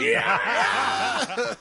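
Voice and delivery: long, drawn-out rocker "yeah"; mock rock yell. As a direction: whoop like a frontman, half laughing. Playing rocker whooping on stage.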